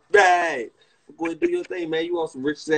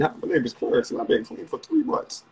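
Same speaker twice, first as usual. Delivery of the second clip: AA meeting share; 3 dB quieter than usual. Pretend. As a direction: introduce yourself at a meeting. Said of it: "This is not an A A meeting".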